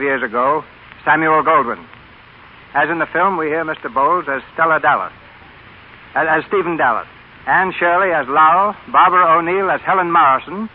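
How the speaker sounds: bright, formal announcer introducing the cast; radio announcer. Acted. Announcer introducing tonight's radio play to listeners, formal and bright. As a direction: present the show to the radio audience.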